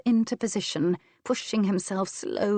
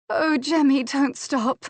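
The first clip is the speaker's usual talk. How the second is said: high, louder breathless pleading; pleading cry; 8 semitones higher, 5 dB louder than usual. A narrator's reading. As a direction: beg him not to stop, rising high. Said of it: "she begged shamelessly".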